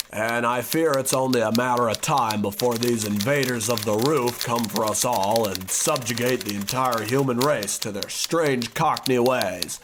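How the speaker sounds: ordinary delivery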